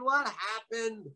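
stretched, high-pitched startled cry; mock screamed question. A narrator's reading. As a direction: draw it out high like a woken sleeper. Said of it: "he screamed".

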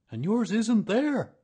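high, loud, slow verdict; insistent bear. A narrator's reading. Bear insisting donkey's tail is missing, emphatically. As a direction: hammer it home, pitched right up.

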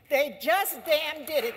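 rising rally emphasis; emphatic repeat. Real, not acted. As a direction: hammer it home the second time.

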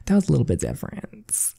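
coy murmured "That was a little bit different"; coy and soft. Acted. Repeats the line, coy.

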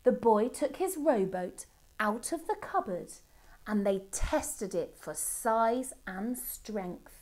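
usual speaking voice

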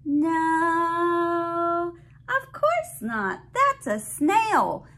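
bright, sing-song picture book reading; reading to a toddler. Acted. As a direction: read it out like to a little one.

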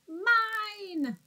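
a long high wail of mine. Acted. Minosaur wails during the tug of war, high wail. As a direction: wail it like a greedy toddler. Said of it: "as he wailed".

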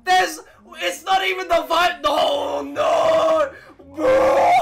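real outcry of dismay; dismayed shouting. Real, not acted. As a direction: wail it in disbelief. Protesting the reveal.

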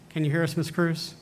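calling out. Real, not acted. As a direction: call out so she can hear you. Calling to a remote presenter.

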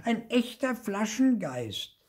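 high, drawn-out exclamation of wonder; thrilled, high-pitched discovery; excited cry. A narrator's reading. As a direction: call it out slowly in amazement. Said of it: "rief Marty".

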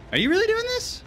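high-pitched disbelieving question; incredulous. Real, not acted. Disbelief at killer's move.

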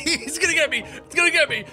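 high, laughing panic; scared laughing. Real, not acted. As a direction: laugh through the fear. Chased, laughing.

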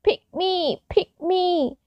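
high, small pleading voice; eager cry. A narrator's reading. Fir tree calling to the tree diggers, eager cry. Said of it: "cried the little fir tree".

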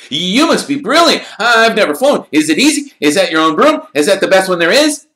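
put-on high, loud, eager boy's voice; excited young fan. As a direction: gush at your hero like a starstruck kid. Colin gushing.

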